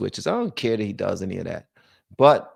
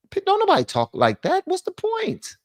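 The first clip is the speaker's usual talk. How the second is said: exasperated. Real, not acted.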